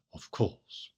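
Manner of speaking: of course dropped to a soft, breathy, half-voiced whisper; dry aside. Real, not acted. Lecturer's dry whispered aside to listeners, softly. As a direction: mutter of course under the breath. Quiet wry aside after moral censure.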